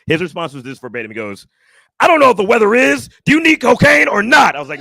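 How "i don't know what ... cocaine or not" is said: loud, high, fed-up demand; exasperated shout. Retold or imitated. Drug dealer answering a coded text, exasperated shout.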